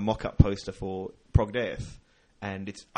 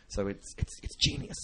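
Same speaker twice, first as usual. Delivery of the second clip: whispered awe; 5 dB quieter than usual. Acted. Awed aside before repeat.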